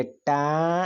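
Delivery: टा with a long, held आ; drawn-out teaching टा; sounding out syllables. Shown as a demonstration. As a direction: stretch the vowel in टा slowly. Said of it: "ये बोले, क्या बोले".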